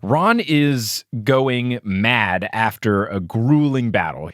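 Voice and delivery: ordinary talk, nothing marked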